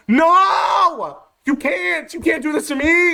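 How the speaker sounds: real heartbreak; crying shout. Real, not acted. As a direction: shout through tears. Character death reaction.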